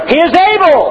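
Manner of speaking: high ringing shout of the preacher; Scottish preacher shouting from the pulpit. Retold or imitated. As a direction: proclaim it to the rafters. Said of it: "he would shout".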